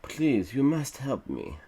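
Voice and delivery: hammed-up British old man's plea; rattling British voice. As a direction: plead like a storybook British villager. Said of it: "in a rattling voice that I sound British for some reason".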